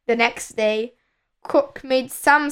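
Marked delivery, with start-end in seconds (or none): none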